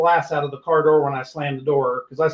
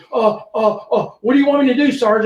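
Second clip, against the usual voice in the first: higher, fast nervous rookie; flustered rookie; 6 semitones higher than usual. Retold or imitated. Rookie asking sergeant what to do, flustered and fast. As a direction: stammer like a lost newbie.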